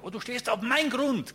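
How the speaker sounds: high, loud territorial shout; angry yell. Retold or imitated. Owner insists the photographer is on his land, angry shout. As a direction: claim your ground loudly. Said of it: "sag er".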